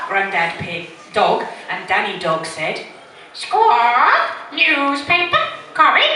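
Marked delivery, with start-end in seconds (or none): 3.3-6.1 high, loud parrot squawk; piercing bird mimicry; copying parrot. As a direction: squawk each word back separately. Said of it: "Polly Parrot copied".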